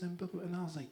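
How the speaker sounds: ordinary talk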